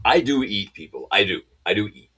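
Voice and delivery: ordinary delivery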